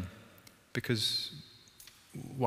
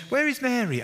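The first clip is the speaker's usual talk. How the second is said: high, loud call for her sister; where has she got to; exasperated Martha; 12 semitones higher, 4 dB louder than usual. Retold or imitated. Martha looking for Mary.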